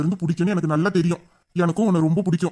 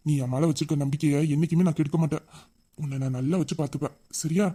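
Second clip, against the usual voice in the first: softer, hushed and gentle; tender soft promise; 3 semitones lower, 5 dB quieter than usual. Acted. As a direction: reassure her quietly, close and gentle. Hero repeats promise, softer. (மென்மையான அன்பான வாக்குறுதி)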